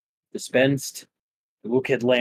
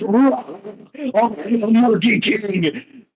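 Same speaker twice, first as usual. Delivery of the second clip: war cry; 9 semitones higher, slower than usual. Acted. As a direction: roar it like a hero. Kong roars his name.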